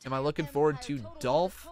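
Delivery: normal talking voice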